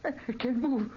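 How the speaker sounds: trapped under the descending canopy; panicked, pitched high. Acted. Trapped man panicking as the canopy lowers, high terror.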